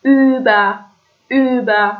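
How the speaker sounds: «اوبا» starting on a rounded German ü, ending in "a"; throaty ü. Shown as a demonstration. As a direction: model «اوبا» with ü and an open final a. («اوبا» با ü از ته حلق و آ در آخر)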